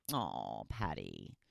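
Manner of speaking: very soft, low, tender aw; soft sad aw. Real, not acted. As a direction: let it break your heart. Moved by a lost pet's message.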